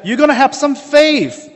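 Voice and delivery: high, loud earnest urging; urging faith. Retold or imitated. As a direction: push him to believe. Ali urges the holy man.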